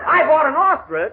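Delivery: high, loud, slow disbelief; can't believe what he's hearing; incredulous. Acted. Boss learning he bought an ostrich, slow high disbelief.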